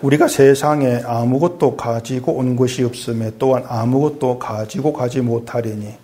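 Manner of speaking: normal talking voice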